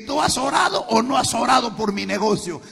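high, loud accusation; furious shout at his wife; angry question. Retold or imitated. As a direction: demand it from her.

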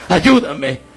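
high, urgent begging, slowing at the end; anguished cry for help. Retold or imitated. Dead man's soul calls on the Lord, anguished high plea. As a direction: cry out to God in reenacted desperation. Dead man crying out to God.

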